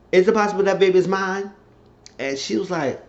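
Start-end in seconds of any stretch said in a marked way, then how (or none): 0.1-1.5 quick, high-pitched pointed question; acted confronting. Man asking whether he fathered the baby, urgent. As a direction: press it urgently, voice raised in pitch.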